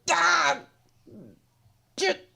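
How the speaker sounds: breathless high voice after laughing; helpless high squeal. Real, not acted. Host voices the slapped wrestler, high and overcome with laughter. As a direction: squeeze the words out high between laughs. Still cracking up, voicing the wrestler.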